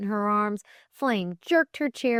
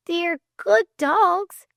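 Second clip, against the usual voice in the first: put-on high, slow, emphatic girl's voice; testifying; 12 semitones higher, 6 dB louder, slower than usual. A narrator's reading. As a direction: vouch for them earnestly, pitched high. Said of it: "testified Flame".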